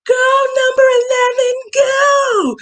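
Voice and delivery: high, loud sideline cheer; shrieked chant of excited fans; cheering squad. Retold or imitated. Girls behind a mom at the football game, cheering loudly. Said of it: "a whole cheering squad behind me".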